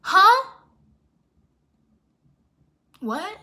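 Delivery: loud, high shocked huh. Retold or imitated. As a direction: blurt out disbelief. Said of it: "retorting to Barbie".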